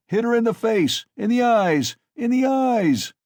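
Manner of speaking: high, loud savage yell; cried out. A narrator's reading. Mikolka yelling at the lads beating the mare, loud high cry. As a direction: urge the beaters on at full cry. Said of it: "cried Mikoka".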